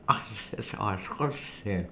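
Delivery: talking around a cork held in teeth; words muffled by a cork between the teeth. Real, not acted. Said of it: "Ich sehe, dass du einen Korken zwischen den Zähnen hast". (mit Korken zwischen den Zähnen)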